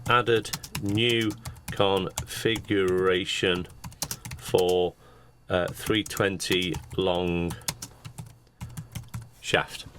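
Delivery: slow, drawn-out words with pauses; dictating while typing. Real, not acted. Presenter voicing a drawing note as they type, slow dictation. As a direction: say it slowly as you type it in.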